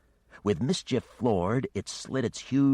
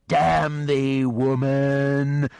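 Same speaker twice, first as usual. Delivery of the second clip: much louder and higher monster curse; cursing dragon; 8 semitones higher, 9 dB louder, much slower than usual. Acted. The dragon curses Geneva before attacking, loud and monstrous. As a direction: spit the curse like an enraged beast.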